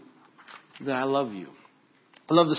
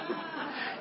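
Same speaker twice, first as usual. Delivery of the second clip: breathy laugh; 13 dB quieter than usual. Real, not acted. Laughs at own impression.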